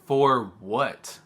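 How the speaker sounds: demanding to know why, half acting it out; indignant "for what". Retelling the officer detaining her, indignant outburst.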